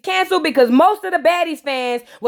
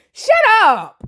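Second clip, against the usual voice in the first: shouting at the screen; 6 semitones higher, 3 dB louder than usual. Real, not acted. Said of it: "yelling at [name] on screen".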